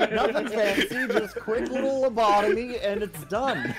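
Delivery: high, giggly delivery; laughing. Real, not acted. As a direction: talk through real laughter. Laughing while describing the finishing blow.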